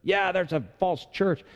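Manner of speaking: high-pitched, dismissive cult-member voice; mock scandalized. Cult member condemning someone's new church, mock scandalized and high.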